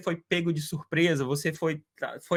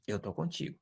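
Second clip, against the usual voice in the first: soft repeat; 3 semitones lower, 11 dB quieter than usual. Acted. Repeated softly as God's voice.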